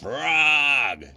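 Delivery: high, breathless call of Frog's name; a little toad calls his friend. Acted.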